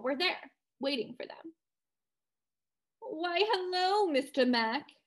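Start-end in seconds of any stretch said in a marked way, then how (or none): 3.0-4.9 slow, higher snooty lockjawed drawl; like she went to college in Connecticut; lockjaw accent. Acted. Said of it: "in a snooty, lockjawed voice".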